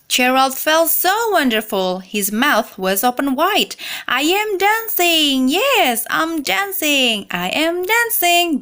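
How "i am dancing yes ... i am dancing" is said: high, joyful exclamation; joyful cry. A narrator's reading. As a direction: burst out with delight. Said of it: "Gerald cried".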